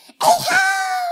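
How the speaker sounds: very high, loud shout; little boy's shrieked fish call; excited boy calling. Acted. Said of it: "and he goes".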